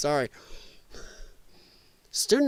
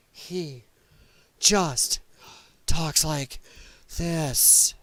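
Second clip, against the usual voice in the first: single words split by pauses; chopped-up, breath-by-breath delivery; halting TV-kid impression; 3 semitones lower, much slower than usual. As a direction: imitate stopping for breath between words. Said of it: "And she talks like that".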